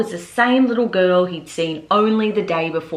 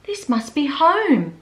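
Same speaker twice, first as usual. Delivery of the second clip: tender wonder; 6 semitones higher, slower than usual. Acted. Bear arriving in the girl's room, content. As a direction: say it slowly, feeling at home. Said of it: "he said".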